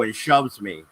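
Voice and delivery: normal talking voice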